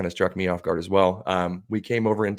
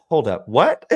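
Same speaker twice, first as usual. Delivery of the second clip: shocked; 2 semitones higher, 7 dB louder, slower than usual. Retold or imitated. Brother-in-law shocked mid-throw.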